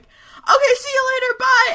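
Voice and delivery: very high, loud mock farewell; panicked goodbye. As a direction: shriek a hurried comic brush-off. Retold panicked goodbye before parents.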